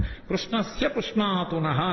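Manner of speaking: slow recitation. Shown as a demonstration. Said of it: "quotes verse line slowly".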